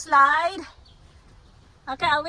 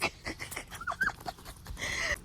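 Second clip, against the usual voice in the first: high airy helpless giggle; breathless laugh; 11 dB quieter than usual. Real, not acted. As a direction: laugh at the messy hair. Child's hair after slide. (hangin nga katawa)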